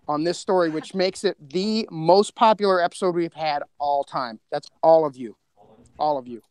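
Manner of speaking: ordinary talk, nothing marked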